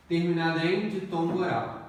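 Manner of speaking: ordinary talk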